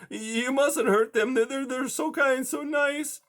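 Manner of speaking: high, timid plea; hesitant high pleading voice; pleading mouse. A narrator's reading. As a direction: beg like a frightened little mouse. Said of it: "Blinker pleaded".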